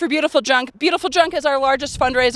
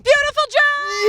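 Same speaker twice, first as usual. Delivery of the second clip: high, slow excited shout; 12 semitones higher, slower than usual. Acted. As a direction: belt it out with glee. Big excited reveal.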